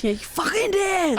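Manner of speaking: high-pitched telling-off; mock scolding. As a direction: scold a dog in jest.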